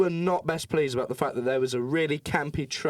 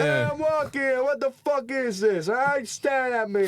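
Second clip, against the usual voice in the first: very high, loud mock New Yorker; New York accent; 12 semitones higher, 3 dB louder than usual. New Yorker yelling at the staring tourists in a mock accent. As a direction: shout like an outraged New York pedestrian. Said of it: "I was not happy".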